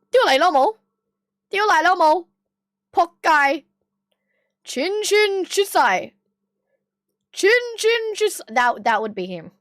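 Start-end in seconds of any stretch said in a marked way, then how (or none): 0.1-8.4 friend impression. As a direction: ham up the friend's silly phrases. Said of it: "And then he'll be like".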